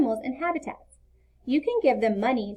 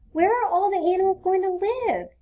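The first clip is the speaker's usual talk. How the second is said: put-on worried small boy, higher and a bit louder; young boy Leo; 9 semitones higher, 3 dB louder than usual. A narrator's reading. Leo asking Mom where the animals will live, worried child voice. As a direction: ask it like a troubled little boy.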